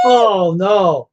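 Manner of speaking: loud, high playful oh no; mock dismay shout. Real, not acted.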